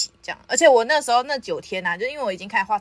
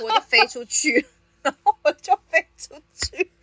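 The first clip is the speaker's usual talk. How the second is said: laughing; 5 semitones higher than usual. Real, not acted. Laughing while telling the crash.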